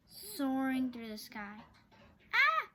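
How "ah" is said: sudden high, loud startled scream. A narrator's reading. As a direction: shriek in alarm. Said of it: "screamed Annie".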